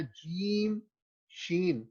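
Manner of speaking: slow, careful جیم and شین; clear articulation. Shown as a demonstration. Teacher modelling letters for students, slow and clear. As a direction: pronounce جیم and شین slowly and distinctly. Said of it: "clearly آپ نے ادا کرنا ہے".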